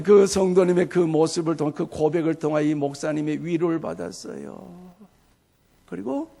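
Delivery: ordinary talk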